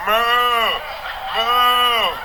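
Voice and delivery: high mooing like a distressed cow; imitated cow moo. Comedian imitates the cow in vegetarian footage, high moo. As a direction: moo like a sad cow on film. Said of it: "footage of the cow going".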